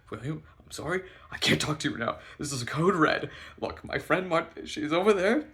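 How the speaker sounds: high, loud, escalating orders; frantic urgency. Retold or imitated. Ordering friend away while running to bathroom, frantic. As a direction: build from apology to panicked command.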